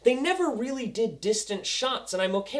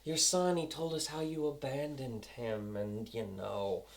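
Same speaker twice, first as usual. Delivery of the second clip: taunting; 6 semitones lower, 7 dB quieter than usual. Retold or imitated. Villain needling a father about his son, softly taunting. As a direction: sink lower and quieter as you needle him. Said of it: "he's taunting him".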